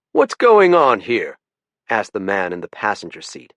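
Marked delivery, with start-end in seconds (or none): 0.1-1.4 put-on slow, higher, louder questioning old man; suspicious old man. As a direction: demand it slowly like an irritated elder. Older passenger demands an answer.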